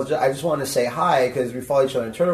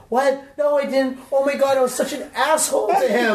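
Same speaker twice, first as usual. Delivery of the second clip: mock horrified; 9 semitones higher than usual. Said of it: "And she goes".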